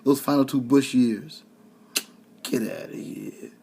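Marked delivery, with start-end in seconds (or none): 2.4-3.5 breathy, soft scoff; barely whispered dismissal; dismissive. Real, not acted. Brushing off Bush-era critics, soft scoff. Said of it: "dismissing again".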